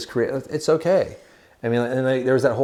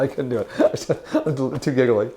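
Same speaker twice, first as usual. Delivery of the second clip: ordinary talk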